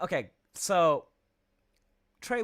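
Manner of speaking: normal talking voice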